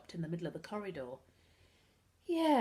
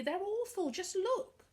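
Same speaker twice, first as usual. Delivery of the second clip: high, pleading complaint; pathetic plea; 12 semitones higher, slower than usual. A narrator's reading. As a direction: sound small and helpless. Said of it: "Harvey said pathetically".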